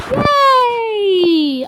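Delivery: high, loud, long-drawn cheer; drawn-out yay; celebrating. Real, not acted. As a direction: cheer it out in one long falling note. Celebrating in game.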